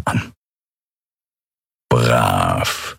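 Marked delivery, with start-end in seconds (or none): 1.9-3.0 very low, drawn-out feline praise; a lazy, pleased old cat rumbling his approval; purring cat lord. Acted. Cat master praising his servant, low and purring.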